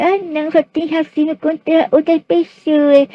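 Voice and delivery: high-pitched mimicking voice, strung out; mocking whine. Speaker parodying others' complaint about racism, high mocking voice.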